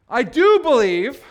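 loud, slow, insistent avowal; emotional declaration. Acted. Preacher voices the father declaring belief, emotional declaration. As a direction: declare it slowly and loudly, as a plea. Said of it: "every bit of emotion and desperation".

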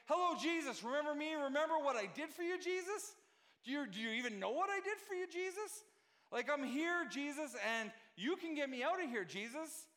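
guilt-trip him with each name; offended attitude. Retold or imitated. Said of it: "This is John's attitude here".

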